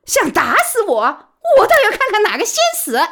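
high, loud and fast; defiant threat. Acted. Baoli brandishing the pole at the thugs, high, loud, fast defiance. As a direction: dare them to come.

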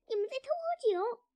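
high, loud accusing squeal; rat's shriek. A narrator's reading. Rat catches the thieves drinking, shrieking. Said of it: "老鼠尖叫道".

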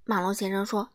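ordinary delivery, nothing marked

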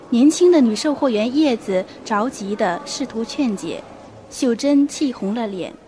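ordinary talk, nothing marked

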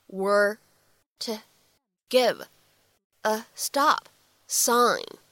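very slow, pause after each word or two; slow separated teaching model. Shown as a demonstration. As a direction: dictate it slowly, each word set apart.

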